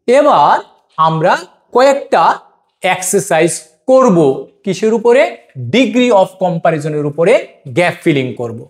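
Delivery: normal talking voice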